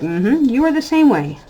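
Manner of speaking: a playful gotcha to her grown son; teasing told-you-so. Pretend. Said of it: "and I'll be like".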